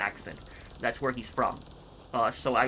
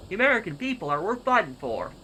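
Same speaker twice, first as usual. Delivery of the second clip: higher-pitched Louisiana accent, speech-making style; Colonel Autumn's Louisiana accent; 6 semitones higher, 3 dB louder than usual. Shown as a demonstration. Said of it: "I'm trying to do that, uh, that, that accent".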